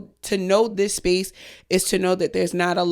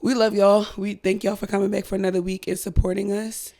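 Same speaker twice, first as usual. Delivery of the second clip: ordinary talk, nothing marked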